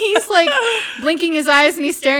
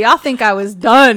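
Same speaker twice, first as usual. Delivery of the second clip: loud, emphatic tease; punchy lead-in to a twist; teasing reveal; 10 dB louder than usual. Real, not acted. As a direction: announce it loudly at normal pitch. Teases audience before the twist.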